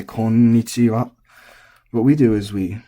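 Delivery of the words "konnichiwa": slow "Konnichiwa" with the double n held long; Konnichiwa, slowly, every sound given; full unshortened form. Shown as a demonstration. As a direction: draw out the nn in Konnichiwa. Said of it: "rare actually to hear somebody say".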